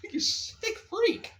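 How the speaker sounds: very high, slow, loud squeal; mock disgust. Friend scolding Rocky over Mickey, hammed-up disgust. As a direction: drag out the scandalised insult.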